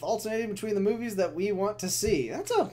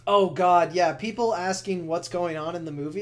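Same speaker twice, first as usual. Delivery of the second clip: ordinary talk, nothing marked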